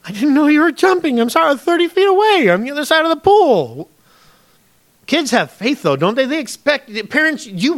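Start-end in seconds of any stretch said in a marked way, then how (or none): 0.1-3.9 high-pitched exasperated excuse; mock indignant self-defense; defensive protest. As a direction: plead innocence in a much higher voice. Father's defensive reply to daughter, retold.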